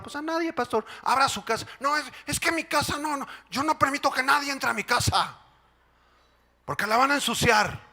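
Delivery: high, whiny church lady; refuse, fussing about her clean house; fussy sister. Pretend. (hermana quisquillosa con voz aguda)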